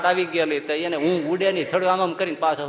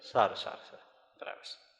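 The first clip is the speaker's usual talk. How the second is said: whispered agreement; 8 semitones lower, 12 dB quieter than usual. Retold or imitated. Swami quoting his mild reply.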